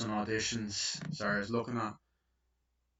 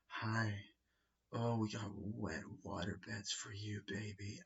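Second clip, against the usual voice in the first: soft, slow, seductive purr; sultry sales pitch; 11 dB quieter, slower than usual. Retold or imitated. Her sexy phone pitch for water beds, soft and seductive. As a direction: coo it like a flirt on the phone. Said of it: "not doing it sexy enough".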